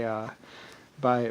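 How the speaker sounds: ordinary talk, nothing marked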